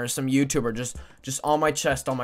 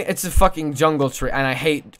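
normal talking voice

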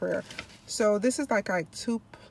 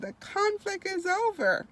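much higher, ringing repeat of the line; emphatic repeat; 11 semitones higher than usual. Real, not acted. Preacher repeating good news to listeners, emphatic repeat. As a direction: lift it high, falling on "over".

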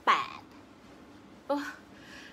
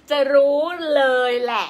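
loud exclamation; 4 semitones higher, 9 dB louder than usual. Real, not acted. Excited exclamation.

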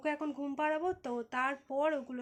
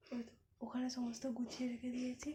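whisper; 6 dB quieter than usual. Real, not acted. Mother whispering to viewers while the baby sleeps. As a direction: whisper it under your breath. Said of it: "আস্তে আস্তে কথা বলছি".

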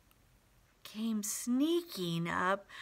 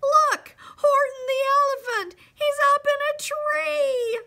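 much higher, loud gleeful shouting; 7 dB louder than usual. Acted. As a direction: yell it out delighted and mocking. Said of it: "they shouted with glee".

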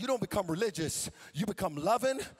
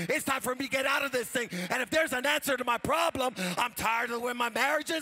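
relentless sermon build, ending in a high shout; full-force preaching; 3 semitones higher, 5 dB louder than usual. Real, not acted. Believer tired of being knocked down, preaching that builds to a shout. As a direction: preach it in driving waves up to one shouted word.

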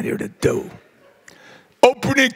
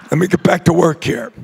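ordinary talk, nothing marked

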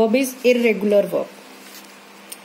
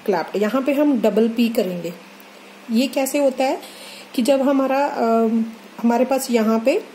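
ordinary talk

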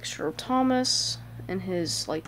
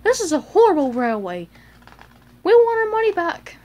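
loud, rising mock complaint; angry passengers; 9 semitones higher, 8 dB louder than usual. Upset passengers complaining about the railway, loud mock outrage. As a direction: demand a refund indignantly. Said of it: "They're like".

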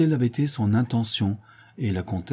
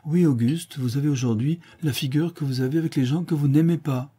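a hint of a higher, teasing lady's voice; lightly voiced countess; 7 semitones higher, 4 dB louder than usual. A narrator's reading. Countess chiding her lover, lightly higher voice.

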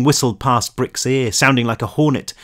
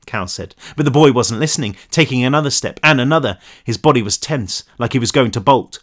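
plain reading voice

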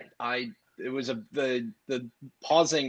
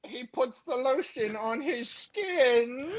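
much higher, slow creepy quote; Buffalo Bill impression; 13 semitones higher, much slower than usual. Friend quotes Silence of the Lambs in a creepy voice. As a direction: creep it out, drawn and eerie. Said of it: "doing that funny impression".

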